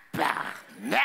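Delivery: low, syllable-by-syllable swearing; deliberate, spaced-out curse; stunned curse. Acted. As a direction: swear slowly in disbelief. Clerk's stunned reaction.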